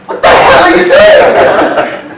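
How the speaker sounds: high, drawn-out shout with laughter; hammed-up high-pitched protest; mock indignant yell. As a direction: play an outraged complaint, half laughing. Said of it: "I want Rishi to come to me and go".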